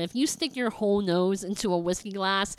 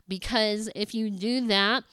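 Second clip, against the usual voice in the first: ordinary talk, nothing marked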